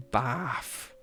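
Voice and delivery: "Bath" with an open short A; short-A bath option. Shown as a demonstration. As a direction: say "Bath" like a stretched "baff". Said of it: "are you saying a bath or bath".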